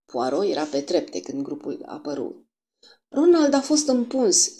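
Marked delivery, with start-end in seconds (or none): 3.1-4.6 put-on high, loud, urgent child's cry; hasty shout. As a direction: shout out the news in a rush. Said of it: "strigă Gerald precipitat".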